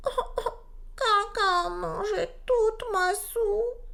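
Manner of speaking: high, slow, crying little voice; little bear crying. A narrator's reading. Little bear finding his soup eaten, high crying wail. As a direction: wail it like a tiny bear in tears. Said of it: "se mit à pleurer".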